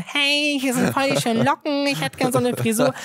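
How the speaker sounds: high, bright mock-sweet request; cheery young customer. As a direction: ask the hairdresser all hopeful and chirpy. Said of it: "und meinte".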